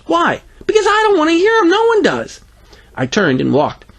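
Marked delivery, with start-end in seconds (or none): 0.0-0.4 put-on high child's why; challenging little girl. Greta, hands on hips, high defiant question. 0.6-2.4 very high, fast, loud retort; exasperated kid. Acted. Boy fed up with Greta, high exasperated outburst.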